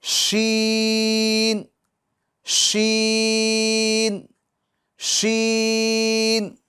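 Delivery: شین held long with the mid tongue on the palate; drawn-out sheen. Shown as a demonstration. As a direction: stretch شین slowly and clearly. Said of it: "اب فرق کرنا ہے اس کے اندر". (شین کو بیچ زبان سے لمبا پڑھیں)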